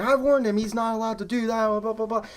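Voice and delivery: high-pitched scolding trailing into blahs; mock indignant manager. Manager justifying himself to employee, imitated high indignant rant. Manager's side of retold argument.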